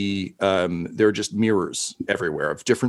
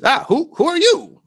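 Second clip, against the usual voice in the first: very high, louder tiny voice; tiny host peeking over the couch, high squeak; little creature voice; 5 dB louder than usual. Acted. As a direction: squeak nervously at the strangers. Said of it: "a little face kinda peeks up".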